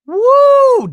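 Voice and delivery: loud, high "woo"; real high-pitched cheer; delighted whoop. Real, not acted. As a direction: let out a piercing whoop. Host's delighted reaction to the line.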